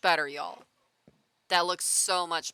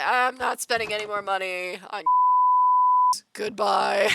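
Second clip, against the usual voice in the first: high, loud mock tantrum; retold fit of anger; 5 semitones higher, 9 dB louder than usual. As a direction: storm off with a loud farewell. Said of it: "I was that level of mad".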